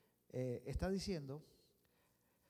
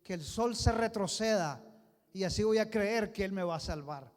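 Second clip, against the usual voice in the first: loud demand; 3 semitones higher, 9 dB louder than usual. Acted. Hezekiah naming his sign.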